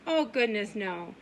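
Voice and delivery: ordinary talk, nothing marked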